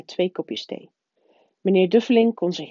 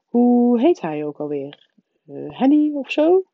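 feigned vagueness; 6 semitones higher, 4 dB louder than usual. A narrator's reading. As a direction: pretend to grope for the name. Man veinst nonchalant de naam kwijt. (gespeeld nonchalant zoekend)

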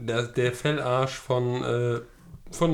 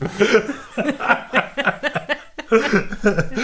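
loud high burst of laughter; laughing fit; 9 semitones higher, 4 dB louder than usual. Real, not acted. Laughing fit over prison joke.

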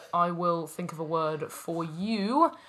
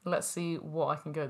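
ordinary talk, nothing marked

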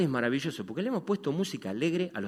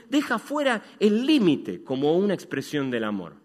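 preaching; 8 semitones higher, 6 dB louder than usual. Acted. Predicación a toda voz.

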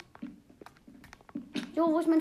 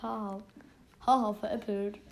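gotcha tease; 4 semitones lower than usual. Real, not acted. Teasing friend after fake-out.

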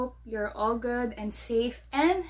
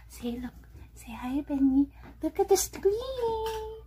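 pet-owner coaxing; 9 semitones higher than usual. Real, not acted. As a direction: coax the dog, pitch climbing high at the end. Coaxing her dog toward the screen.

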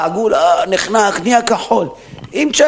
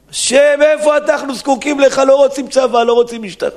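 higher, raised plea to God; pleading cry; 10 semitones higher than usual. Retold or imitated. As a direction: beg God for help, voice lifted. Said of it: "היו צוחקים לאלוהים".

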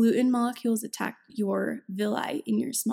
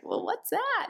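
baffled question; 13 semitones higher, slower than usual. Retold or imitated. Retelling asking about the diagnosis.

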